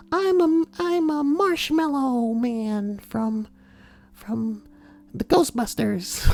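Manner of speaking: very high, slow meek voice; high hesitant mock nerd; timid nerd. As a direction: answer shyly in a squeaky voice. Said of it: "nerd answering at the door".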